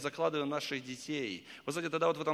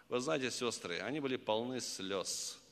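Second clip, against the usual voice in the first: normal talking voice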